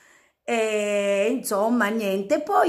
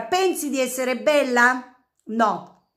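usual speaking voice

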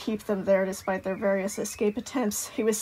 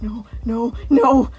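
rising panic; 2 semitones higher, 6 dB louder, slower than usual. Acted. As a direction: build from murmured dread to a cry.

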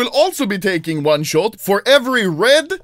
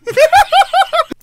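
squealing burst of laughter; high squealing laugh; 5 dB louder than usual. Real, not acted. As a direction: shriek with laughter. Laughs at Glock joke.

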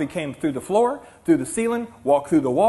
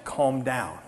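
low, soft calming voice; imitated four-year-old; 8 semitones lower, 8 dB quieter than usual. Preacher imitating small daughter's daycare lesson, soft and low. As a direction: mimic a child's earnest soothing. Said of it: "she'll say".

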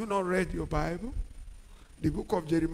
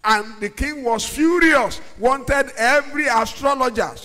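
loud, higher storytelling; fiery preaching; 5 semitones higher, 7 dB louder than usual. Acted. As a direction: preach the tale with fire.

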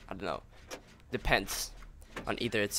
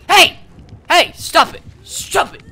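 much higher and louder shouts to stop; frantic yelling; 14 dB louder than usual. Real, not acted. Gamer yelling at a player attacking him, frantic shouts. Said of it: "for screaming and yelling".

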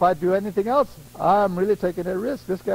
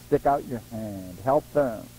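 low urging; 11 semitones lower, 5 dB quieter than usual. Shown as a demonstration.

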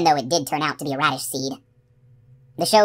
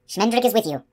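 higher, proud declaration; a magician's proud flourish; 6 semitones higher than usual. A narrator's reading. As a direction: announce yourself with pride. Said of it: "said proudly".

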